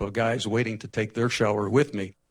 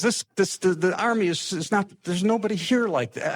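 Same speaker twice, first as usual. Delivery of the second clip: lightly voiced general, pitched up and slowed; a hint of the general chuckling as he praises; amused general; 8 semitones higher than usual. General praising the young recruit, amused and high.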